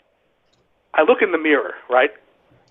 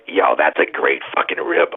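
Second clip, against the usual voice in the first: a much lower, slow dude voice; imitating Hack; 13 semitones lower than usual. Hack walking in thinking it's a gimmick, low and slow. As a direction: drop it low and laid-back. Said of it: "Hack walks in and goes".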